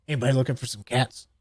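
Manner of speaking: shady street seller. Retold or imitated. Rogue in trench coat selling cats.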